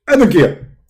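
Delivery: high, loud, indignant preacher voice; Zakir Naik impression. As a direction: bark it like a self-righteous debater. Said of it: "My name is Zakir Naik".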